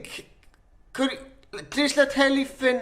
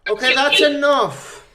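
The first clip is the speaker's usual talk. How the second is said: loud, slow, firm order to stop; firm cut-off; 7 dB louder, slower than usual. Real, not acted. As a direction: put a loud stop to the heckling. Host cuts off the heckling.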